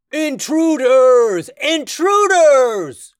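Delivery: very high, loud, slow alarm cry; booming voice. Acted. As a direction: boom it out, dragging each word. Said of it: "a booming voice echoed through the chamber".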